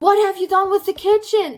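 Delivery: high, loud, rushed accusation; mock angry brother. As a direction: imitate a brother exploding at his sister. Brother confronts sister about kitchen.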